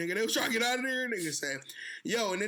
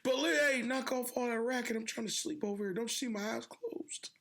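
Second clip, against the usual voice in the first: higher, grumbling old-man impression; Biden; 5 semitones higher than usual. Old president trying to sleep, mock cranky. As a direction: grumble like a dozing grandpa disturbed. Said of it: "China Biden".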